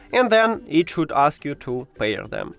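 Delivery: ordinary delivery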